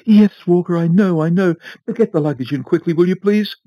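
high, loud, hurried and impatient; impatient Mrs Foster. A narrator's reading. Wife urges the butler to load the luggage, high and impatient. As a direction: brush him off, hurry him along.

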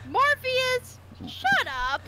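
high, loud scolding outburst; exasperated yell. Real, not acted. Telling Morpheus to shut up, exasperated yelling. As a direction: shout at a friend to quit it.

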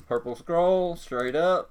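ordinary talk, nothing marked

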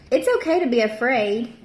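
ordinary delivery, nothing marked

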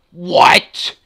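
sharp shocked yell of "What?"; shocked shout. Real, not acted. Gamer yelling at an unfair death, shocked shout. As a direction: blurt it out in loud disbelief. Real outburst at game surprise.

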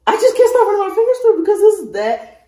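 thrilled over her new wig; gushing excitement. Real, not acted. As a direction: rave about the hair, can't contain it. Raving over the hair.

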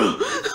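high embarrassed giggle; nervous laugh. Real, not acted. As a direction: burst into a flustered giggle. Shocked at being recognized.

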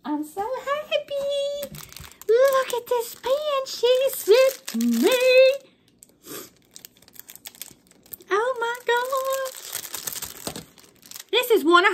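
3 coo over the pen like a treasure; 4 thrilled by a friend's gift, high and slow; 1 squealing delight. Real, not acted.

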